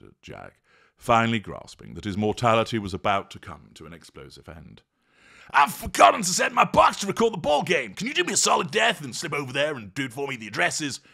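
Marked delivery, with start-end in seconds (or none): 5.5-11.0 high, loud, singsong pleading; frantic dude. Acted. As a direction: gabble the favour fast.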